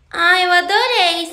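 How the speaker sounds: sarcastic delight. Acted.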